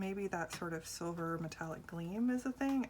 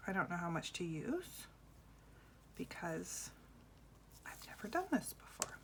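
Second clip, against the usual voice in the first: whispering; 7 dB quieter than usual. Real, not acted. Whispering while working.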